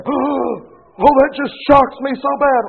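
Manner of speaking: high, hammed-up gasp of parental shock; mock the parent who can't believe it; clutching pearls in mock disbelief.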